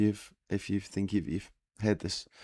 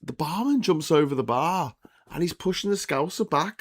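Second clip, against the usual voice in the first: ordinary talk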